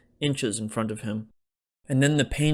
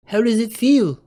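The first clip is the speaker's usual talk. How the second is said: put-on worried young guy; 11 semitones higher, 8 dB louder than usual. A narrator's reading. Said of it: "Tommy asked".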